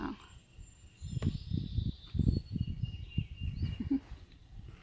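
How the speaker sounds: a little laugh to herself. Real, not acted. Laughs before starting to eat.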